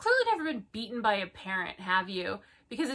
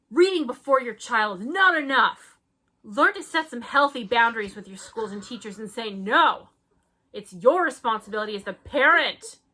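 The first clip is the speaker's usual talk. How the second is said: mock preachy warning; 3 semitones higher, 9 dB louder than usual. Hammed-up reading of parent warning.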